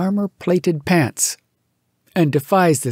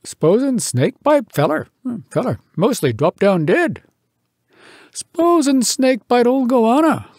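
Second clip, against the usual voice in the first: raised, deliberate storyteller voice; King Billy speaking; 8 semitones higher than usual. Acted. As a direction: tell it like a wise old elder. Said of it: "told him".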